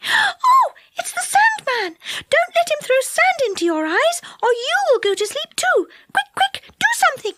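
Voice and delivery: very high, panicky fairy voice; frightened squeak. A narrator's reading. Fairy warning friends about the Sandman, squeaking in panic. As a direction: squeak in alarm and urge them to act. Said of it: "gave a squeak".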